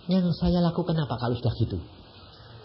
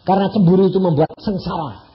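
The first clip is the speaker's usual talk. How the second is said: God's stern voice; 4 semitones higher, 6 dB louder than usual. Retold or imitated. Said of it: "Tuhan bilang".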